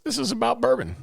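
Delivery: high, bright reply; eager reply. Retold or imitated. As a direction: brush off worries, eager. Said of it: "But your answer was".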